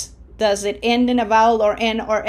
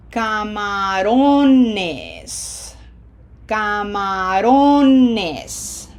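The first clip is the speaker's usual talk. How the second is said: "Camarones" very slow, long loud "-ro-" before "nes"; stress on second-last syllable; 4 dB louder, much slower than usual. Shown as a demonstration. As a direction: stretch "Camarones", swelling on the "ro". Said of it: "now it has an extra syllable, and by default, it falls there".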